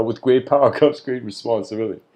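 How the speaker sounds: quick quote broken by laughter; laughing through it. Retold or imitated. Jokes about Uncle Ben's line, laughing. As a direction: giggle through the famous line.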